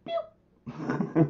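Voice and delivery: very high, soft "pew"; imitated zip noise. Host showing the UFO go through, playful zip noise. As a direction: mimic something tiny shooting past.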